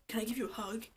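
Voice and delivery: soft whispered offer; whispering. Retold or imitated. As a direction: whisper it tenderly. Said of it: "He's like".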